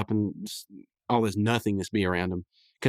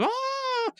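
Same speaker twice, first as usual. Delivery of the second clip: mock falling yell. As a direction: imitate a long scream at small volume. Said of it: "and he's yelling".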